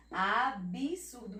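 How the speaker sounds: absurdo slow, said "a-bi-surdo"; "Absurdo" with a separate bi syllable. Shown as a demonstration. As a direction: put an audible "i" after the B in Absurdo. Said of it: "como se ela tivesse um I".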